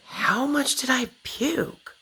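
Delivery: high, slow, small voice; quoting herself to nurse. Retold or imitated. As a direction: ask it timidly, high and slow. Asking nurse after surgery.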